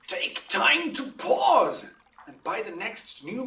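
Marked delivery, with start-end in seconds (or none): 0.1-1.9 higher, louder, slow and weighty; a duke's grave command; stern warning. Acted. Duke warning Hermia to reconsider, stern slow command.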